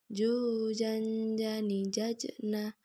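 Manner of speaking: "Ju" diayun, n pada "jan" ditahan; ayun dan tahan. Shown as a demonstration. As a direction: lantunkan "Ju, jan, jani, jajna" dengan dengung tertahan. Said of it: "tahan semuanya". (tahan dengung pada "jan")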